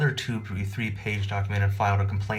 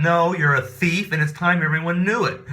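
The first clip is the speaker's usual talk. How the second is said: slow, louder and higher denunciation; loud slow accusation; 7 semitones higher, 7 dB louder than usual. Retold or imitated. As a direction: shout it for the whole hall. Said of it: "very loud voice".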